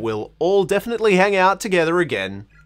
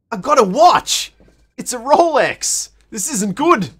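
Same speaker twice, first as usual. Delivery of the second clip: mock excitement; 6 semitones higher, 4 dB louder than usual. Joke on name Seiko.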